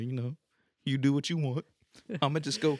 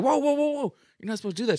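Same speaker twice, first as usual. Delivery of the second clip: alarmed whoa; 10 semitones higher than usual. Retold or imitated. Retold alarm, laughing.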